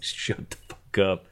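whispered urgent hush; hushed warning. Retold or imitated. Narrator hushing drunk Scott in the bar, whispered. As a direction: hiss it at a drunk friend.

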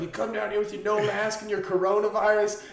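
high-pitched imitation of a complaining neighbor; mock scolding neighbor. Retelling an angry neighbor's complaint.

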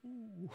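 high, very soft "ooh"; hushed shocked "ooh". Retold or imitated. The whole dinner table reacting to her words, hushed "ooh". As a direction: imitate a stunned table's quiet ooh. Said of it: "everyone at the table was like".

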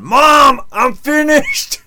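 put-on high, loud whiny teen yell; mock teen hollering down the hall; kid yelling to mom. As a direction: shout it up like a kid done with his song.

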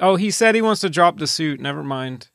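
normal talking voice

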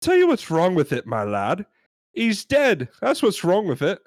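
high, indignant British customer; hammed-up British complainer; British accent. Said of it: "We're gonna try our British accents".

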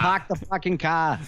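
put-on Boston accent. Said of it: "We sound like we're from Boston".

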